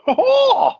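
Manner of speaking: high squawking seagull cry; seagull impression. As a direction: screech like a gull. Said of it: "That was my seagull impression".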